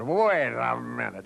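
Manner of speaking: put-on slow, slurred drunk protest; drunk man. Drunk casino customer protesting to the security guard, slurred and slow. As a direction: drag it out like a drunk. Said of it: "You've had too much to drink".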